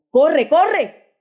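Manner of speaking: a high, loud push to run; urgent shout. A narrator's reading. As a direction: shout at him to get going. Father pushing son to run.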